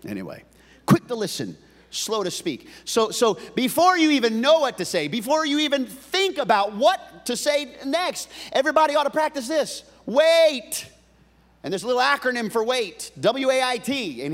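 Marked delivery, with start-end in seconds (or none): 2.8-14.2 loud, high sermon build; rising pulpit energy; preaching at full force. Acted. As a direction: drive the point home to the room.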